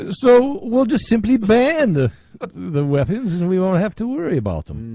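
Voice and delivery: put-on high, slow Jersey accent; broad hammy Jersey accent. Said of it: "I'll do my Jersey accent again".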